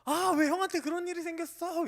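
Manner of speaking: loud, high outburst of grief; sudden yell. Retold or imitated. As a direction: cry out for your friend's pain. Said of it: "소리를 버럭 지르면서". (친구의 일에 버럭 소리 지르며 울먹이듯)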